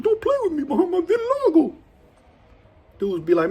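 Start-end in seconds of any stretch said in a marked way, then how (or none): 0.0-1.7 high put-on voice; mock his touchy warning; imitating Honky Feet. Storyteller imitating Honky Feet scolding inmates. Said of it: "he talked like this".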